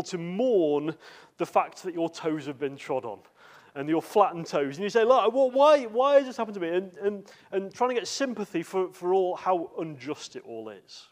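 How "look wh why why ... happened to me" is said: high, loud self-pitying wail; mock whining. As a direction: put on a whine, fishing for sympathy. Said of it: "Some people begin to whine".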